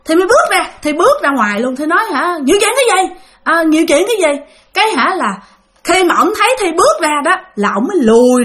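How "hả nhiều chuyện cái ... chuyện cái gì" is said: re-enacted indignant comeback, higher pitch; acted-out irritated questioning; angry retort. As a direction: challenge him back, mildly heated. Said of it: "Cái Thi tức quá".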